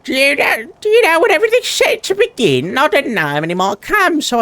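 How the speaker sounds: high, eager townsfolk gush; excited villager. Acted. Villager rushing up to the hero, excited high chatter. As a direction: bubble over like a keen young villager.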